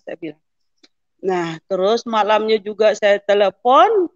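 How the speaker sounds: normal talking voice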